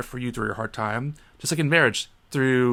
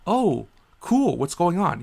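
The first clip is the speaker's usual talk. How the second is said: mock cheerful; 5 semitones higher, slower than usual. Own reply retold.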